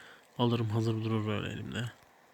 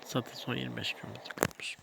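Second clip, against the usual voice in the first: sleepy; 5 dB quieter than usual. Real, not acted. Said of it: "gözlerim kapanıyor".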